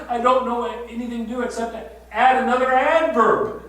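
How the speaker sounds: pushing past tears to say it; emotional pleading. Real, not acted. Emotional plea, rising intensity.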